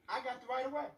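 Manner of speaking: ordinary delivery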